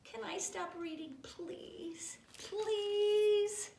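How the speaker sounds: high plea, building to a loud wail; beg, drawing out the last word; mock pleading. Reader begs to stop reading, high mock-desperate plea.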